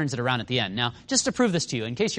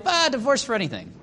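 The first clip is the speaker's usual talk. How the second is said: dismissive; 8 semitones higher than usual. Retold or imitated. Scribes casually waving off God's standard.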